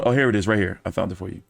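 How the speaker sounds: ordinary talk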